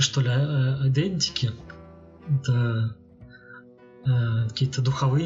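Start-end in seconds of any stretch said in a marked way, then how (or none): none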